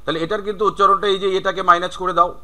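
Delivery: normal talking voice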